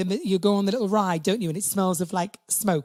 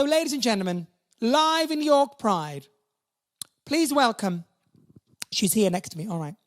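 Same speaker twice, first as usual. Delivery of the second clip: showbiz announcer; 5 semitones higher than usual. Acted. Compere introducing the next act to the York Pride crowd, grandly. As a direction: present the star like a compere.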